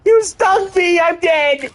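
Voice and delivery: yelping high in mock outrage; high outcry. Real, not acted. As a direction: squeal it out, stung and dying. Dying in game, crying out.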